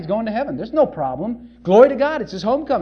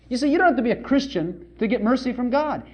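usual speaking voice

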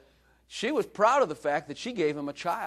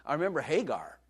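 normal talking voice

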